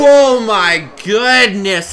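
loud, high, drawn-out exclamation; thrilled amazement. Real, not acted. Commentator amazed by the chest blow, thrilled cry. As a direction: cry out at a massive hit. Reacts to a big hit.

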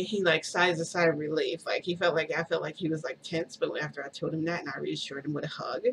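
ordinary delivery

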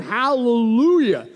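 long drawn-out hallelujah; shouted praise. Acted. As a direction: proclaim it like a crowd in heaven. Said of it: "great multitude in heaven shouting".

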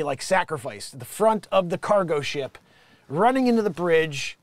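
normal talking voice

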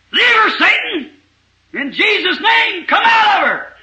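high, slow, shouted command; full-throated commanding shout; casting out shout. Retold or imitated. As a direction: roar the exorcism at full force. Casting out a devil.

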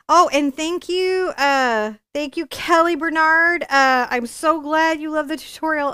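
excited thanks. Real, not acted. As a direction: gush a loud, bright thank-you to a viewer. Thanking a viewer by name.

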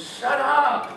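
firm high command; commanding rebuke. Retold or imitated. Jesus rebuking wind and waves, firm command. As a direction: tell the storm to stop. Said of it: "looks at wind and waves and says".

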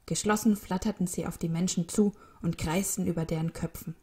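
ordinary delivery

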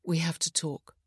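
ordinary delivery, nothing marked

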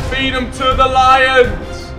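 slow, drawn-out rallying call; dramatic battle cry. Real, not acted. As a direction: hype it up like a commander. Urging player forward, dramatic.

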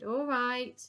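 loud, high exasperated sigh of a reply; sighing consent. A narrator's reading. Old queen giving in to the Admiral's plea, sighing. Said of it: "The old queen sighed".

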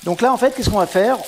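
put-on southern French accent, cheerful and loud. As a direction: ham up a southern French accent like a Marseille cook. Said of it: "je vais prendre mon accent du Sud".

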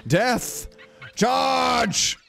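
drawn-out "charge" call; mock battle cry. Player sending creature to attack, mock battle cry.

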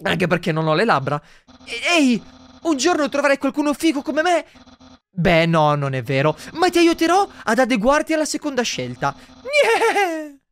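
lightly voiced cocky skeleton ending in a laugh; boastful tease; Papyrus voice. Papyrus teasing the human, cocky laugh.

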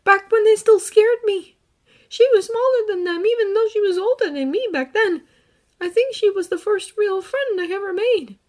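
put-on earnest boy, gently recounting; young hero voice. Izuku about Rei.

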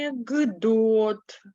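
"Анекдот" said slowly with a voiced г; slow pronunciation demo. Shown as a demonstration. Teacher to class, slow spoken-form demo. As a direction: draw out "Анекдот", the к turned to г. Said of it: "Вимовляємо анекдот".

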